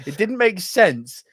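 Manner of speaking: ordinary talk, nothing marked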